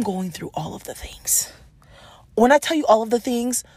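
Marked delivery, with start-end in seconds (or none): 0.0-1.5 whispering. Real, not acted. Overwhelmed aside about transitions.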